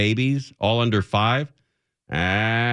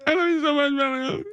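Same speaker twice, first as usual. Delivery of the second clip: high, hammed-up sobbing goodbye; fake-sob the farewell up high; mock crying; 13 semitones higher than usual. Host parodying the crying sendoff, high mock sobbing.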